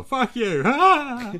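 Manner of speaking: high, loud playful yell; mock yell. Real, not acted. Shouting back at his cohost's jab, high and loud.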